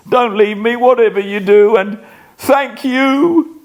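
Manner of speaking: high, fast pleading; cling to her in fear; desperate begging. Acted. Dying man begging wife.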